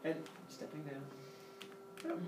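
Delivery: normal talking voice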